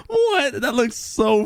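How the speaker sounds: a squeaked high what, breaking from a laugh; can't believe his eyes; stunned surprise. Real, not acted. Sees weird world in game.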